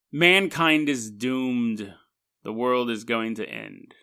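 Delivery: slow, dropping prophecy of doom; mock doom prophet. Man after his visions warns his friends, slow and ominous. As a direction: draw it out like a hammed-up prophet.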